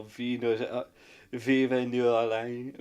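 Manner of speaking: slow, hammed-up French accent on vive New Orleans; mock French accent. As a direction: attempt a French accent and fail. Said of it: "I can't do French".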